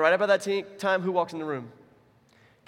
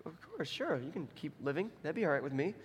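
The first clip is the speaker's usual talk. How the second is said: soft, offhand royal murmur; mock the king agreeing, very quietly; imagined puzzled king; 9 dB quieter than usual. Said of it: "You can imagine Xerxes going".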